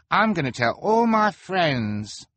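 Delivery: high, slow, eager voice; a thrilled onlooker; high spectator voice. A narrator's reading. Spectator speaks.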